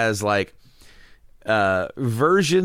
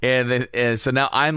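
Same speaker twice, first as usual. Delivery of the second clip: ordinary delivery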